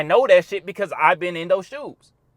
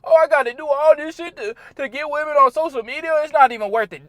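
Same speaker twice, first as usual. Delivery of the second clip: higher, mocking moan about effort; whiny complainer; 8 semitones higher, 4 dB louder than usual. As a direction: sneer at the guys who say it's not worth it. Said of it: "Some motherfuckers gonna complain".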